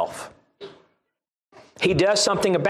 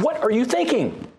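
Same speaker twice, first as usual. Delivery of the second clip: higher-pitched, incredulous self-scolding; exasperated talking-to, pitched up; shaking oneself in rebuke; 7 semitones higher than usual. Pretend. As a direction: demand it as if shaking yourself awake. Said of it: "shake ourselves and say".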